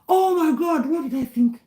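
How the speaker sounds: gasp, then a high shocked "oh my God"; the sudden horror of a blunder; real startled embarrassment. Real, not acted. Realizes she misread a viewer.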